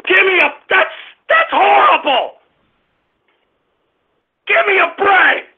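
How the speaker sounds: high-pitched exasperated rant; angry outburst. Real, not acted. Host yelling at an insulting caller, angry outburst.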